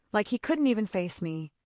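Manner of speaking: ordinary delivery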